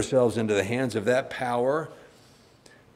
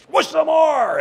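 high, loud spotter's shout; gym yell; 9 dB louder than usual. Retold or imitated. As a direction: bark encouragement at a lifter. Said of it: "your friend yells at you".

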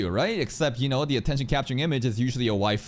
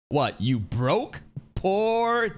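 teasing, slow then pitched-up jab; mock taunting; 8 semitones higher, much slower than usual. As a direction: jokingly rub it in. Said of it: "No, I'm kidding".

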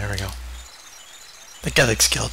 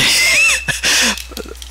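shrieking burst of laughter. Real, not acted.

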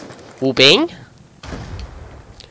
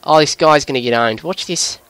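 put-on Aussie accent; Australian accent; 2 semitones lower than usual. Gamer explaining his voice to viewers, Australian accent. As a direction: chat away in a broad Australian accent. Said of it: "keep to this accent".

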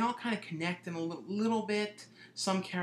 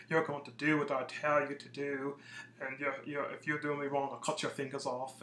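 put-on peculiar British accent. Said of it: "he's doing this kind of peculiar British accent".

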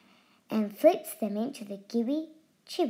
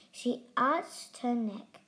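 plain reading voice

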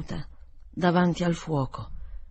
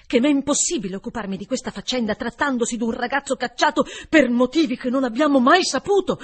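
firm, fast and louder; relentless tone; 6 semitones higher, 8 dB louder, faster than usual. A narrator's reading. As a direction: lay it down without mercy. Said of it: "su un tono inesorabile". (tono inesorabile, deciso, rapido e più forte)

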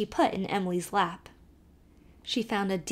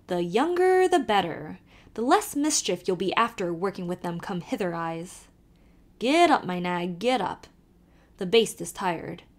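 put-on lively Irish accent, calling to the horse; old Irish driver calling out; Irish carter; 2 semitones higher, 3 dB louder than usual. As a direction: urge the nag on in a brogue. Kelly teases about a beau.

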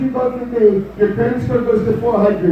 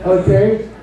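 ordinary talk, nothing marked

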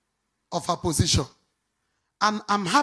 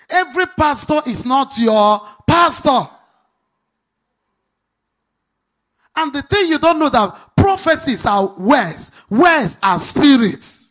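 louder and higher, building; preaching at full force; 5 semitones higher, 8 dB louder than usual. Acted. Pastor urging the church to find their pastor, building to a loud climax. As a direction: rise to a pulpit climax.

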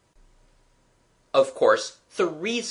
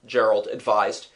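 plain reading voice

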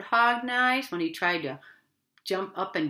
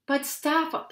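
mild parrot squall, higher pitched; lightly voiced parrot, pitched up; 7 semitones higher than usual. A narrator's reading. Parrot objects to bouncing the hippo, mild squall. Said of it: "squalled the parrot".

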